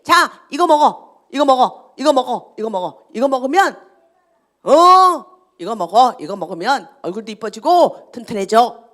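loud, insistent king pressing food on captives; mock royal order, pushed out loudly; imitated commanding king. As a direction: urge them loudly to eat, as the king. Voices king pushing food on captives.

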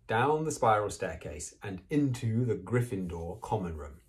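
ordinary delivery

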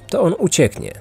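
ordinary delivery, nothing marked

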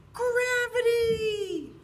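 loud, high, drawn-out shout of Gravity; shouted word. Shown as a demonstration. Teacher leading the kids to shout the word while jumping, loud and high. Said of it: "say the word gravity as loud as you can".

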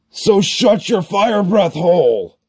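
high-pitched, exasperated comeback; heated, high-voiced snap; angry retort. Snaps back at the heckler.

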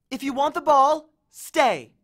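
high, loud bargaining order; dog command. A narrator's reading. Annabeth orders the monster to wait, commanding. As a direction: lay down terms to a dog. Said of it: "Annabeth ordered the monster".

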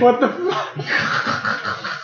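breathy laughed what the fuck; laughing disbelief. Real, not acted. Laughing at partner's reply.

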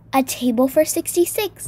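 usual speaking voice

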